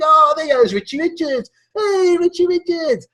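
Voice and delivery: put-on high kid voices calling his name. Schoolkids hailing Richie in the playground, high calling voice. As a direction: call out to him across the playground.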